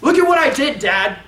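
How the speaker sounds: imitating a kid's sudden high, loud reveal; excited shout. Boy startles Dad with his certificate, acted excited shout. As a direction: burst out loud and high, mock-triumphant. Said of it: "He's so excited".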